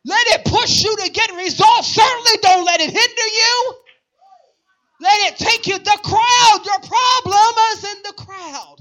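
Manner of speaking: high, driving preacher voice building to shouts; full-force preaching. Real, not acted. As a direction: build each phrase to a loud punched cry.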